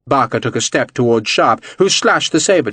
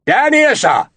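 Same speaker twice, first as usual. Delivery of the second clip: shouted reply; 11 semitones higher, slower than usual. A narrator's reading. Servant shouting up the hill to his master. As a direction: bellow back up to the officer. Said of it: "Barker shouted".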